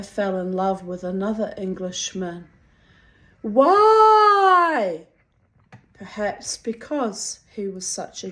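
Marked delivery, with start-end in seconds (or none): 3.4-5.1 sudden high, loud "why"; exasperated high cry. Real, not acted. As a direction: cry out in disbelief.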